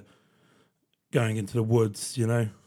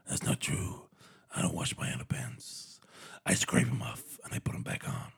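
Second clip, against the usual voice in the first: much lower, softer, rasping tough guy; Dirty Harry voice; 13 semitones lower, 9 dB quieter than usual. Retold or imitated. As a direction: grumble back as a gravel-voiced cop. Dirty Harry answering flatmates.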